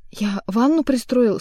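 terrified cry. A narrator's reading. Bloodied Magarych pleading, slow loud terrified cry. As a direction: blurt it out in terror. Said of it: "стуча зубами, кричал".

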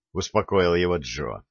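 usual speaking voice

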